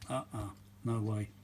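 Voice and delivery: ordinary talk, nothing marked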